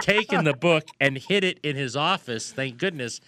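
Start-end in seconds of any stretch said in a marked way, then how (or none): none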